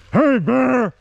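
loud flat yell at a bear; monotone yell. Shown as a demonstration. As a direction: shout it high and loud, one note. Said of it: "basically yell at it in a monotone".

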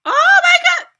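shrieking at the top of her lungs; ecstatic scream. Real, not acted. Fan shrieking at her bias on screen. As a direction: scream out loud, overwhelmed. Fangirl screaming at music video.

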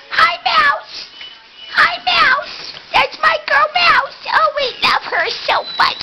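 high pet-talk voice. Real, not acted. Owner greeting her dog Mouse, high gushing pet voice. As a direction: gush over the little dog.

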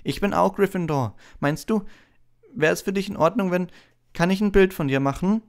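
high, timid, eager request; nervous young fan asking a favour; hopeful Colin. A narrator's reading. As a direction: ask hopefully in a high boyish voice. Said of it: "fragte er und hob hoffnungsvoll".

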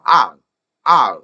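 "아" short and loud, gliding fast to a dark ending; quick reduced sound. Shown as a demonstration. Teacher models reduced I will, "아" short and fast. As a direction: give "아" as one quick punch. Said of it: "아, 으 정도인데 빨라요".

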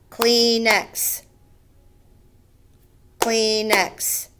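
"Kleenex" in two even beats, klee, nex; split into syllables. Shown as a demonstration. Teacher counting two syllables, "Kleenex" beat by beat. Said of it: "Two syllables".

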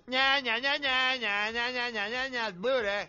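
mocking nyah-nyah chant; childish taunting. Contestant mocking his small rival, taunting. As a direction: tease with repeated nonsense syllables.

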